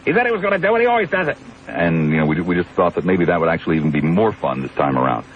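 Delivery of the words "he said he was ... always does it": high, fast put-on voice of a doubting boss; high mocking impression of a doubting boss; mock Shorty disbelief. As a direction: squeak out the boss's disbelief. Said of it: "would probably not believe the person".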